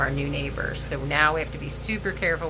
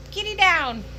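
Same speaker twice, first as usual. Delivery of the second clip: excited; 12 semitones higher than usual. Real, not acted. Cat falls off.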